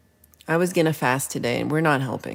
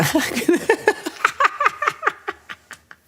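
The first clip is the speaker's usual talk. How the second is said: high laugh. Real, not acted. Cracking up at a viewer's joke. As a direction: burst of high-pitched laughter.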